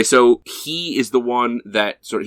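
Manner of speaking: ordinary talk, nothing marked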